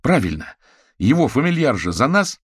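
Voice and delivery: high, loud, eager agreement; exclaiming. A narrator's reading. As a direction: jump in excitedly with the argument. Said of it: "воскликнул Кузя".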